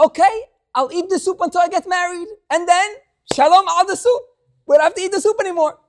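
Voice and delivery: high, loud, gleeful retelling; crowing at freedom from the soup; excited vow. Retold or imitated. Husband vowing to quit the soup, loud high glee.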